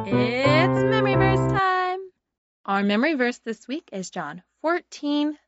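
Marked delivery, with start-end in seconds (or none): 0.1-2.0 high, slow sing-song announcement; excited announcement. Acted. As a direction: announce it like a show host. Announcing segment over music.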